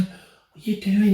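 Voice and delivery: drawn-out puzzled wife; imitating wife. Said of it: "she's going".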